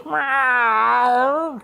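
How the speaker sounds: high, put-on meow; cat meow. GM voicing the cat at the water's edge, mock meow. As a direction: mew like a wary cat. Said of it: "She goes".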